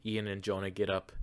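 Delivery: usual speaking voice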